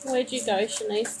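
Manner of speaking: ordinary delivery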